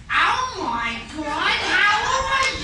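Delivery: high, loud gushing greeting; Italian mother-in-law greeting. Retold or imitated. Mother-in-law greeting the Irish family, high gushing. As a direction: gush like a big-haired Italian mom. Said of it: "She's like".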